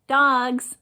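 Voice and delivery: ordinary talk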